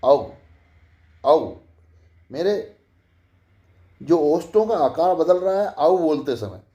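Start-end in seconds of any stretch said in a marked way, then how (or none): 0.0-1.5 औ said open and rounded; au vowel. Shown as a demonstration. Teacher corrects ओ to औ for students: rounded औ. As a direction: open the mouth wide into औ. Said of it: "ओ नहीं, औ".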